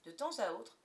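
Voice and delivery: usual speaking voice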